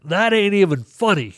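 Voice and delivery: high, loud, incredulous protest; exasperated outburst. Retold or imitated. Retelling his reply to God's voice, high exasperated protest. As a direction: snap back like it's a bad joke. Retold reaction to God's answer.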